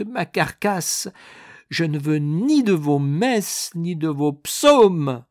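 slow, high, loud exasperated tirade; strained, drawn-out angry cries; furious outburst. A narrator's reading. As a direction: rage at them both from the deathbed. Dying man rages at both clergymen.

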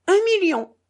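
high, loud, amused burst; amused exclamation. A narrator's reading. Mitya answers how much he will stake, amused and loud. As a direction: throw it out high, as if laughing. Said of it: "dit Mitya en riant".

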